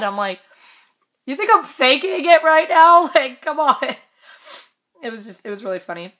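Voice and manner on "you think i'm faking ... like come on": high, loud mock outrage; retold incredulous outburst; indignant comeback. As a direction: protest in exaggerated disbelief, half laughing. Retold indignant comeback about faking.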